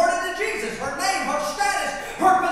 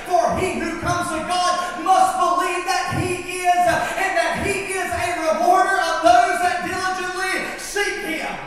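louder rising preacher's cadence; preaching scripture; 4 semitones higher, 5 dB louder than usual. Acted. Pastor quoting Hebrews to the church, building preach. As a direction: drive the verse home to the crowd.

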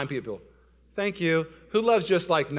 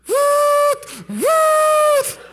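two piercingly high, loud whoops; 8 dB louder than usual. Retold or imitated. Husband showing how mad he is, high loud whooping. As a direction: whoop it out like a siren going off. Imitates noise while so mad.

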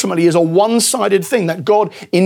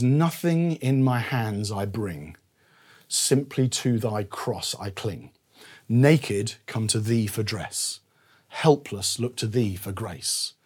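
slow and much softer, each line savoured; reverent hymn recital; 7 semitones lower, 9 dB quieter, slower than usual. Shown as a demonstration. Preacher reads a favourite hymn verse to the congregation, slow and hushed. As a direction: recite the old hymn slowly, almost prayerfully. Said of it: "one of the verses goes like this".

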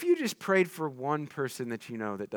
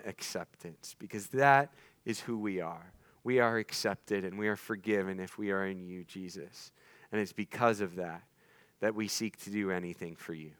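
closing prayer; 4 semitones lower than usual. Acted.

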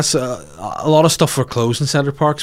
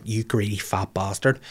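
slow, soft scornful insult; imagined contempt; 2 semitones lower, 8 dB quieter than usual. Retold or imitated. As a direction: sneer it under the breath. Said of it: "I didn't want her to be like".

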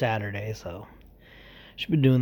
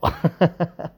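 short laugh; 4 semitones higher than usual. Real, not acted. Laughing at own story.

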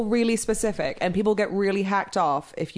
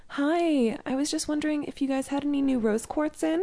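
put-on high, light Californian accent; airy Santa Barbara crystal-shopper, California accent; 7 semitones higher, 5 dB quieter than usual. Shopper asking for rose quartz, high soft California accent. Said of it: "That's a pretty good California accent".

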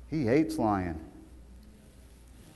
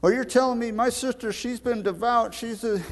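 imitating the inmate; 11 semitones higher than usual. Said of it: "And he said".

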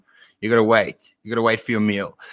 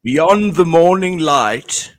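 slow, higher-pitched documentary narration; wildlife narrator; 6 semitones higher, 3 dB louder, much slower than usual. Pretend. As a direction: stretch it out like a nature-film voiceover. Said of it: "one of those wildlife narration".

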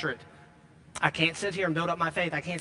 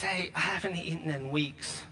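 softer, pleading ask at the door; hungry stranger pleading; 6 dB quieter than usual. Retold or imitated. Starving stranger at the door begs the giver, softly pleading. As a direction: beg quietly for a little food.

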